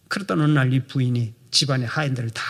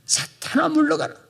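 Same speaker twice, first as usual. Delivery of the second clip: firm cry; 11 semitones higher than usual. Shown as a demonstration. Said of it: "단호하게 소리쳐야 합니다".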